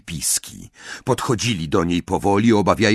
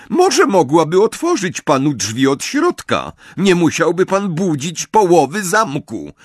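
high, louder, reproving voice; prim professor; 8 semitones higher, 5 dB louder than usual. A narrator's reading. Professor scolds Amisius for waking the castle, coolly pointed. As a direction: reprove with cool, pointed politeness.

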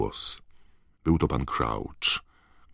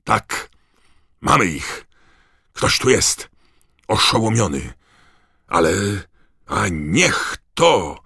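high, loud calls with gaps; excited yelling, ending in dismay; distant triumphant shout; 7 semitones higher, 7 dB louder than usual. A narrator's reading. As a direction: shout the find back to the others. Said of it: "usłyszeli krzyk pana Diggorego".

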